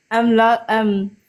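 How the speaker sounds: ordinary talk, nothing marked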